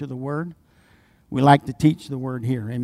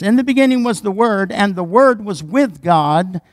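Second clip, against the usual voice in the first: raised, ringing preacher's voice; preaching; 4 semitones higher, 6 dB louder than usual. Acted. Pastor preaching John chapter one to his church, full voice. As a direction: preach it out to the congregation.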